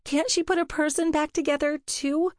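a hint of sniffly pleading; tearful plea. A narrator's reading. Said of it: "Allegra sniffled".

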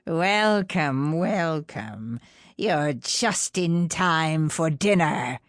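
slow, sinister crone greeting; an old witch, sweetly menacing. Acted. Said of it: "The way she said it".

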